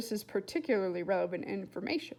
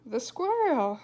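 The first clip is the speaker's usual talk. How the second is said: louder, dazed and odd; strange voice; 3 semitones higher, 8 dB louder, slower than usual. A narrator's reading. Mother answering William, strange and dazed. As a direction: sound strangely detached. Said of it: "in her strange new voice".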